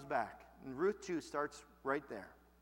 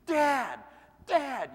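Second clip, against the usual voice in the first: put-on loud, high child's cry of Dad; urgent call; 7 semitones higher, 10 dB louder than usual. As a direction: call out for your father in alarm, much louder. Son bursting in calling dad.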